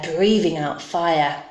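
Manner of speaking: ordinary delivery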